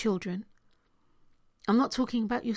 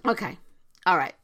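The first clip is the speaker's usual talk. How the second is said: ordinary delivery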